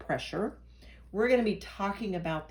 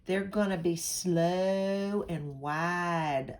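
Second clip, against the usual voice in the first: slow and drawn out; much slower than usual. Shown as a demonstration. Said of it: "like a Southern accent".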